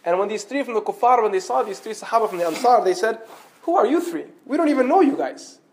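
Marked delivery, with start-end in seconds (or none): 3.6-5.6 high-pitched, incredulous dismissal; scornful challenge. Acted. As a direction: challenge them as if they are nobodies.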